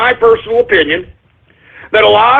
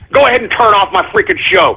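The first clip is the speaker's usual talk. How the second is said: usual speaking voice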